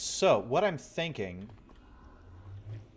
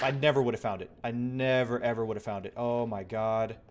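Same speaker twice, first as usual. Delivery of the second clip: ordinary delivery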